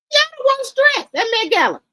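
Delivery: high, loud scandalized exclamation; real outraged verdict. Real, not acted. Scolding celebrities over their outfits.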